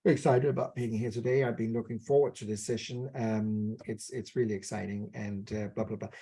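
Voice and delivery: low, soft, trailing off with no enthusiasm; deliberately dull, quiet and low delivery; yawning, bored. Shown as a demonstration. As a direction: claim excitement while yawning through it. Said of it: "I'm yawning when I'm saying I'm excited".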